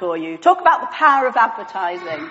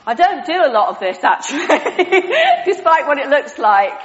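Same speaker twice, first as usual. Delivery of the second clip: talking through real laughter, a bit louder; laughing; 5 semitones higher, 5 dB louder than usual. Real, not acted. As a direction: laugh as you admit it. Amused at own props, laughing.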